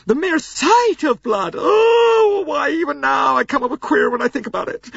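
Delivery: very high, loud, theatrical gushing; fussy hysterical American lady. A narrator's reading. Mrs Hubbard recovers, still shrill with horror. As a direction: gush about your delicate nerves.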